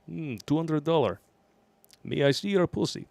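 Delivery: lightly put-on Russian accent; a hint of Russian accent. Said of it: "I'll do a little Russian accent".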